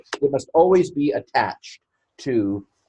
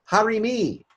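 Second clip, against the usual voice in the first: Harimi said again much higher and quicker; high quick repeat; 11 semitones higher than usual. Shown as a demonstration. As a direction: toss Harimi off high and fast.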